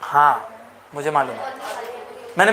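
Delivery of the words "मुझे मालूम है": ordinary talk, nothing marked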